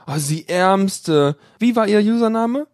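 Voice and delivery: ordinary delivery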